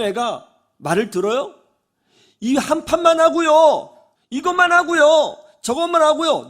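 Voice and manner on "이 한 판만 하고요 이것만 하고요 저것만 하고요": put-on whiny child. Child begs his mother for one more game, high whine. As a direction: whine like a kid begging for one more round. Child begging to keep playing.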